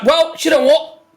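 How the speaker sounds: loud, high good-loser voice; gracious concession. Acted. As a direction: concede with a big bright shrug. Woman concedes the bet, loudly.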